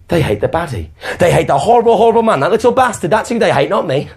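rising gleeful triumph; gleeful relief. Acted. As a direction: gloat in sudden relief. Queen's relieved gleeful realisation.

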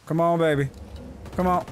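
usual speaking voice

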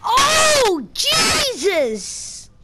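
very high, loud cry; shrill startled shout; startled yelp. Real, not acted. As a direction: yelp in sudden shock. Startled outburst.

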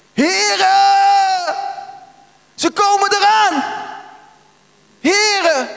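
high-pitched acted scream to the Lord; crying out. Israelites crying to the Lord as Pharaoh's army comes, screamed. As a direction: shout it out in alarm, stretched and high. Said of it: "Ze schreeuwden tot de Heere".

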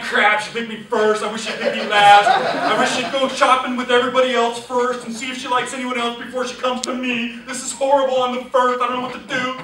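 loud, panicked inner voice of a nervous man; mock panic. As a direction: play up the panic of being picked first. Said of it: "Do you think he's going".

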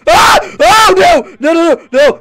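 sudden high scream of real fright, then panicked noes; terrified screaming. Real, not acted. Gamer jump-scared by the monster screams in panic. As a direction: shriek as the monster jumps out.

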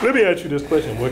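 words stretched by a yawn. Real, not acted. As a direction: talk through a big yawn. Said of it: "that terrible yawn".

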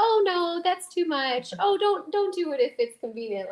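high, loud mock protest; hammed-up high polite refusal; imitating her fussy protest. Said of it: "the first thing she will do is be like".

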